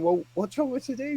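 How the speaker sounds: high, fast, mock-helpless whine; a hammed-up helpless protest to the boss; exasperated whine. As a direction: play up the put-upon worker, pitched high. Retold reply to boss.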